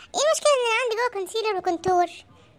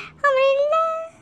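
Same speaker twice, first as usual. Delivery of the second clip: resigned; 6 semitones higher, 3 dB louder, much slower than usual. Acted. Resigned comic surrender.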